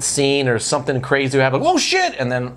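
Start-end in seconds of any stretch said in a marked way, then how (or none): 1.6-2.1 sudden high yelp of surprise; surprised shout. Retold or imitated. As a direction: blurt it out, startled and high. Mock reaction to sudden violence.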